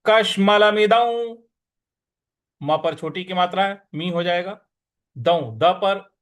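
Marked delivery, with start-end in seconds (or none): 0.1-1.4 कश्मलमिदं slow, louder, with weight on कश; stressed recitation. Shown as a demonstration. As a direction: lean on the श in कश्मलमिदं. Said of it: "उस पर हमको थोड़ा सा आघात देना है".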